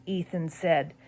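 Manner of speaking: ordinary delivery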